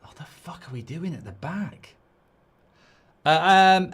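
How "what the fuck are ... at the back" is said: hushed, breathless whispered curse. Real, not acted. Fan whispering a curse at his defence. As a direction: whisper it through clenched disbelief.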